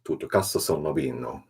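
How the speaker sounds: loud mock-Italian delivery; badly faked Italian, projected; Italian accent. Shown as a demonstration. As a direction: put on a hammed-up Italian accent. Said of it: "Oh, my Italian accent".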